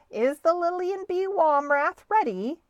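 lightly voiced referee, pitched high; referee's question. A narrator's reading. Referee checking on the second boat, calling out. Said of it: "asked the referee".

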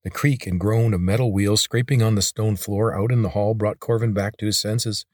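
ordinary delivery, nothing marked

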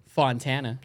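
"Fontana" with English vowels, slowly; English pronunciation. Shown as a demonstration. Friend giving an acceptable example, "Fontana" said the English way. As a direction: anglicize "Fontana", unhurried. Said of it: "but you could say, like".

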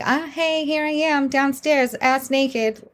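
high, sing-out mock greeting; mock cheery call-out. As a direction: pipe up high as if answering the parents. Mock answer to the searching parents.

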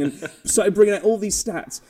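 ordinary talk, nothing marked

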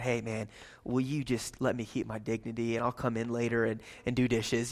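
quiet, pleading aside to the manager; low and soft, begging for your dignity; hushed plea. Pretend.

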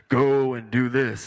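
slow, loud command from above; booming God voice. Acted. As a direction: boom it out like a voice from heaven. God's imagined command, quoted.

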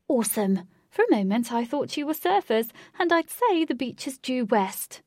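loud, high posh banter; posh accent. A narrator's reading. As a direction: joke boisterously in a posh accent. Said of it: "He said in a posh accent".

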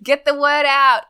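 high, loud rallying cry; playful shout. Pretend. Signing off the podcast with a playful shout. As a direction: call it out as a mock slogan. Mock rallying callback to own plug.

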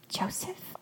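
hushed, questioning whisper; very soft, voiceless call. A narrator's reading. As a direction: whisper his name to call him over. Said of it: "I whispered".

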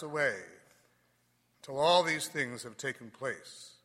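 solemn Gospel proclamation; cathedral echo. Acted.

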